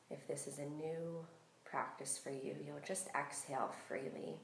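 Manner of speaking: calm, unhurried meditation voice with long pauses; guided meditation. Real, not acted. Yoga teacher settling listeners into breath awareness, calm guided meditation.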